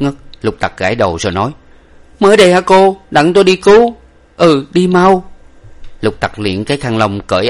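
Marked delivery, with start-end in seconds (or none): 2.2-5.2 high, loud, hurried resolve; rally to the rescue, high and loud; urgent servant. A narrator's reading. Servant rushing off to save them, urgent.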